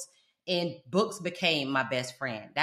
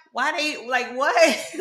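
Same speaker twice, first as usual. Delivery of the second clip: higher, startled confusion; a puzzled, higher what; baffled reaction; 8 semitones higher, 5 dB louder, much slower than usual. Retold or imitated. Confused immigrant girl answering the teasing, higher and baffled. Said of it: "And I'm like".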